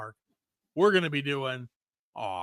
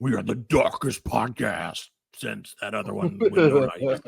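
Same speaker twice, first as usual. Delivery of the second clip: extremely deep bass voice; 6 semitones lower, slower than usual. Pretend. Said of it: "So dark".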